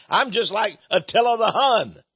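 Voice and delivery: a hammed-up echo, as if he can't believe it; mock disbelief. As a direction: toss the insult back, amused and disbelieving. Echoing the insult in mock disbelief.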